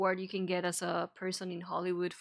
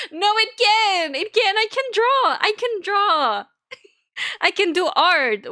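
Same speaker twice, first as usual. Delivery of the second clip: indignant protest; 9 semitones higher, 6 dB louder than usual. Real, not acted. Defends her PC to chat.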